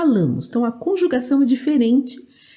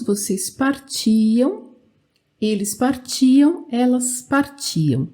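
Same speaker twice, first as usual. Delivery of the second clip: each "partia" form slow, TI held long; stress on TI. Shown as a demonstration. Teacher drilling the same stress pattern, stress on TI. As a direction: drill "partia" forms deliberately, leaning on TI. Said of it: "Também a mesma coisa".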